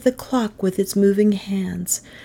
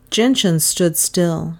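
plain reading voice

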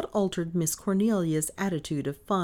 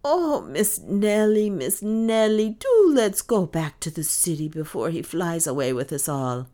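pleading; 4 semitones higher, 6 dB louder than usual. A narrator's reading. Maid begging mistress to return to city, pleading. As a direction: beg her, stretching out the name.

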